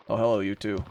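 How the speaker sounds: ordinary talk, nothing marked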